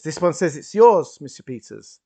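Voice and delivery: high, loud announcement of a find; calling out. Acted. Waiter returns a found wallet, calling out loudly. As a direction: call it across the room.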